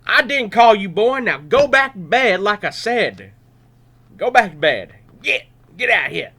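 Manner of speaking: put-on exasperated priest ordering boy to bed; firm loud scolding. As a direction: put your foot down with the boy. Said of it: "said firmly".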